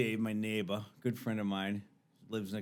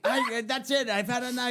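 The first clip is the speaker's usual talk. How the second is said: high-pitched mock exasperation; retold last straw; fed-up protest; 11 semitones higher than usual. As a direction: give up in a raised, exasperated voice. Called man is fed up.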